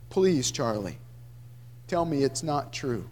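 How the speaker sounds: weeping plea. A narrator's reading. Father weeping the plea to his brother Charlie. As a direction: weep the words out, low and soft. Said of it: "I wept the words from my mouth".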